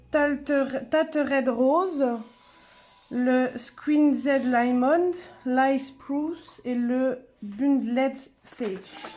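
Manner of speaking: English colour names in a French accent; slow, loud, careful English with a French accent. Real, not acted. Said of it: "désolée pour mon accent".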